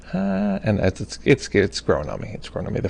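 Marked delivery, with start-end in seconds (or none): 0.1-0.6 high-pitched questioning huh; mock puzzled reaction. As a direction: imitate being thrown, voice shooting up. Retold doubtful reaction to new show.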